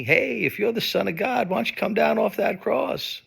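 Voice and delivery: high, loud jeering taunt; mocking. Crowd jeering at the man on the cross, mocking. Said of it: "when they were mocking me".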